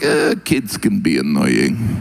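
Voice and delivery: lightly voiced weary Russian; deadpan Russian. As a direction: shrug it off like nothing. Russians voiced, low slow.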